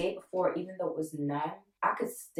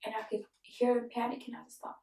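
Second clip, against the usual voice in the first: normal talking voice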